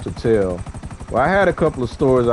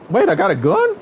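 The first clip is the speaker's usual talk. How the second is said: sudden high-pitched double take; surprised. Real, not acted. Streamer surprised to find a gun in game, high startled question. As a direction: caught off guard, voice jumping up.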